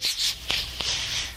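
unvoiced long shushing; whispered shush. Peter hushing disciples, whispered shush. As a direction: hush them in a whisper.

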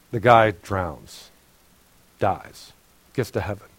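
ordinary talk

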